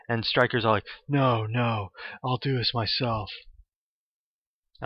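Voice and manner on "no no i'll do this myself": a hint of the Matrix dude, barely voiced; lightly imitating the Matrix dude; Matrix dude impression. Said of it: "did that impression of friggin' d-, that Matrix dude".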